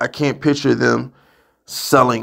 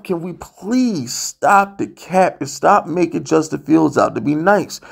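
usual speaking voice

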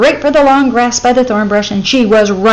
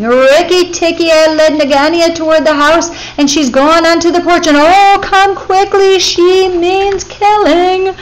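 high, loud frantic bird scream; 8 semitones higher, 6 dB louder than usual. A narrator's reading. Bird wife screams warning to Rikki Tikki, loud and high. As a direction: scream for help much higher and louder. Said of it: "heard Darzee's wife screaming".

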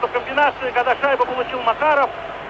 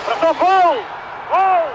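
excited hockey goal call; 5 semitones higher, slower than usual. Real, not acted. Commentator calls a Soviet goal. (восторженный крик «гол»)

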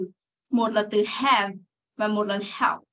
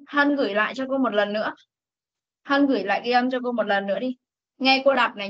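ordinary talk, nothing marked

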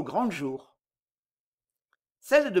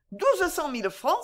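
shocked exclamation; 9 semitones higher than usual. A narrator's reading. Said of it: "s'écria madame Séchard en pâlissant".

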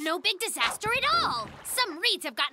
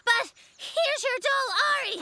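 out of breath; 5 semitones higher than usual. Acted. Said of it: "I'm a little out of breath".

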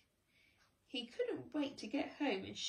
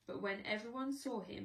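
ordinary delivery, nothing marked

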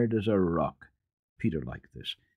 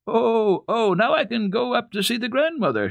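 high, loud, joyful cry; joyful shout; 4 dB louder than usual. Acted. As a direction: shout it out with delight. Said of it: "shouted Heidi joyfully".

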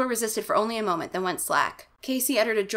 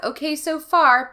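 higher, louder and slow, called out; calling back; 8 semitones higher, 7 dB louder, slower than usual. Acted. Casey calling up to Margaret, loud and slow. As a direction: shout it up the stairs to his sister. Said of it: "then calls back".

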